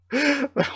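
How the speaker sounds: short, high laugh; real, high-pitched chuckle; amused laugh. Real, not acted. Reader amused by chapter's ending.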